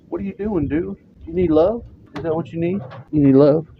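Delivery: high cooing questions to a cat; pet talk. Real, not acted. Owner asking his cat if it needs love, high cooing pet talk.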